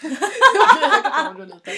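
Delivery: laughing hard, slow and high. Real, not acted. Telling her friend about a Tinder heartbreak, laughing hard.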